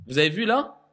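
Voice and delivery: ordinary delivery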